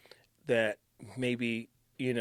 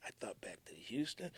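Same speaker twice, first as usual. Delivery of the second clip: hushed memory; whispered reflection; 5 semitones lower, 14 dB quieter than usual. Real, not acted. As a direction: drop to a whisper. Somber reflection.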